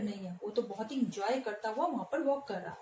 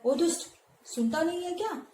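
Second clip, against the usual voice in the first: higher, hot-tempered yell; angry shout; 7 semitones higher, 4 dB louder than usual. Acted. Teacher voicing Bakasur yelling at Bhim in anger. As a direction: shout at someone who ignores you. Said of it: "Bakasur गुस्से में चिल्लाता है".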